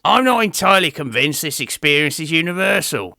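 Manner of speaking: ordinary talk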